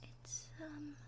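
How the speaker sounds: gentle, hushed ASMR character voice, often whispering; soft-spoken roleplay girlfriend. Acted. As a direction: talk softly to the nervous listener.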